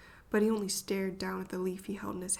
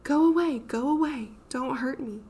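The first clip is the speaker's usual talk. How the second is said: shrieking, higher and much louder, then fading into pleading; scream; 5 semitones higher, 8 dB louder than usual. A narrator's reading. Ghostly child screaming at its tormentors, terrified. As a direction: scream in terror, then beg. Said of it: "it screamed".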